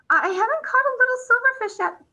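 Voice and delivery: high, quick and stubborn refusal. A narrator's reading. Smudge refusing Hare a turn, stubborn and high. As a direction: dig in your heels, won't give it up. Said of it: "said Smudge stubbornly".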